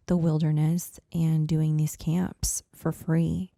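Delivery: ordinary delivery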